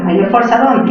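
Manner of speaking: normal talking voice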